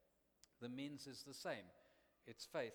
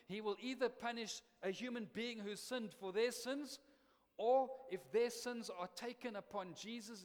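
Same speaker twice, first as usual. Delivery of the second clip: louder, higher, slowed and emphatic preaching; full-force preaching; 5 semitones higher, 6 dB louder than usual. Acted. Preacher declaring God's justice to the church, full-force preaching. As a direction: thunder out that God punishes sin.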